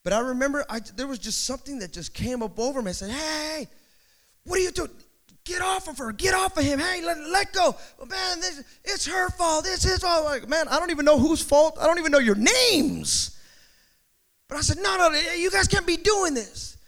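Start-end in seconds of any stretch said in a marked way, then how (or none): 3.1-13.3 high-pitched yell without full volume; mock shouting. Preacher retelling pulling a fighting couple apart, mock shouting. 14.8-16.8 high, urgent mock scolding; retold stern protest. Preacher retelling scolding a fighting couple, high urgent protest.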